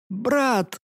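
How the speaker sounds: strained. Acted. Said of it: "выдавила из себя".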